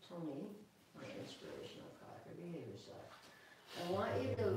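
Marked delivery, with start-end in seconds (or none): 0.1-3.0 whispered, far softer, coaxing the dog. Real, not acted. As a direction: hush him so he settles. Hushing the dog while grooming.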